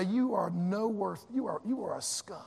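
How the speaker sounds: hushed scornful put-down; imagined scornful brother. Retold or imitated. The elder brother turns his ragged brother away, scornful and hushed. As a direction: sneer it low, like an elder brother.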